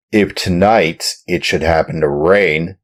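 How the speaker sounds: loud, rough, commanding farmer. Acted. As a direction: order the puppet about roughly. Farmer orders the chained puppet.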